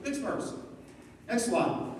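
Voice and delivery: ordinary talk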